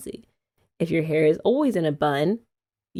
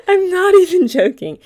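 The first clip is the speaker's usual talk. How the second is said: blurting it out high and loud through laughter; cracking up mid-story, insisting it's true; laughing; 12 semitones higher, 9 dB louder than usual. Real, not acted. To her partner about the burnt-hair video, laughing hard.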